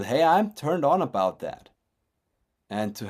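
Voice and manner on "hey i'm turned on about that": eager exclamation. Retold or imitated. Voicing her own open passion.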